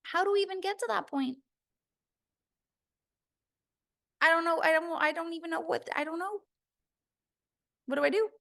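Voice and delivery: high-pitched, hesitant questioning voice; acted confusion, high and faltering; imitating unsure listeners. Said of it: "some of you will be like".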